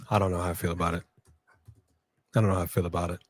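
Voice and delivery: low, soft grumble; doubtful undertone. Real, not acted. Host unsure about the UFC deal, low doubtful aside.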